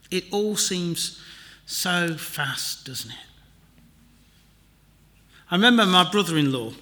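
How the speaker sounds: ordinary talk, nothing marked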